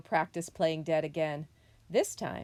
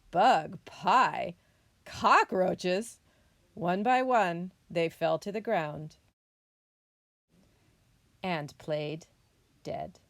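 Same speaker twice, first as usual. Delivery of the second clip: animated, louder picture-book narration; story reading to children; 5 dB louder than usual. A narrator's reading. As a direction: tell it clearly to young listeners. Reads picture book to students.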